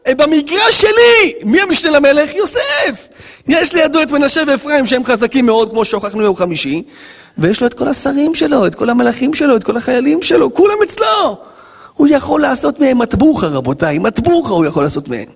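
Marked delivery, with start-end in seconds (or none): 0.1-11.4 triumphant shout. Acted. Joseph boasting of power.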